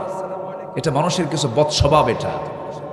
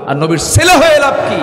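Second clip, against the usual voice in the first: much higher, loud challenge to the crowd; rhetorical shout; 11 semitones higher, 7 dB louder than usual. Acted. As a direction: fling the question at them. Preacher's rhetorical climax. (উচ্চস্বরে প্রশ্ন)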